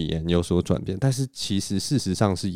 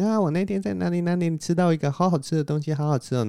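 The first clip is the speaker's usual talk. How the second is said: put-on much higher girly chatter voice; soft thin voice; 9 semitones higher than usual. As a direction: gush about the tasty food, girly. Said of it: "这就是女生mode".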